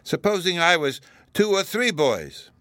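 put-on high, drawn-out little-boy triumph; triumphant. A narrator's reading. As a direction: finish the winning point slowly and proudly. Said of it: "Bruno exclaimed triumphantly".

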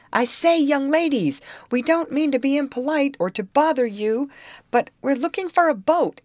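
high, loud hail across the water; calling out. A narrator's reading. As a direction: call out to get their attention. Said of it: "now called again".

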